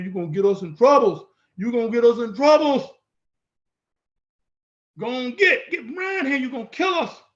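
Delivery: a scared buddy freaking out, mock alarm; mock panicked friend. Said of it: "You be sitting over there shaking in your boots, talking like".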